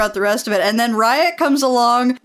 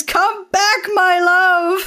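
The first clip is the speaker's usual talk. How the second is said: high, hammed-up lovelorn cry; overacted, high-pitched desperate call; mock scream; 8 semitones higher, much slower than usual. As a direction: wail it like a melodramatic lover chasing her. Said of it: "chases after her and screams".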